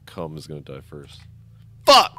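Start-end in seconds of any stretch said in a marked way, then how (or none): 1.8-2.1 shout. Real, not acted. Sudden loss in game.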